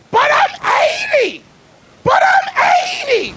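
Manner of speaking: put-on high, loud, cracking old man's protest; old man yelling. Arrested old man yelling at the police, high and loud. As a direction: shout it as a panicked eighty-year-old. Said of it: "he just kept yelling".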